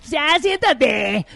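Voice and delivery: aguda y muy lenta, coqueta; voz de ñero. Pretend. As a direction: mujer ñera arrastrando las palabras. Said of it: "imagínate una mujer". (mujer ñera que te invita a sentarte)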